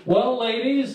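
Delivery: ordinary talk, nothing marked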